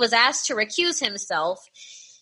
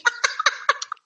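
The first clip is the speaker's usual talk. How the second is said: breathy high wheeze of laughter; wheezy laugh. Real, not acted. Laughing at roast.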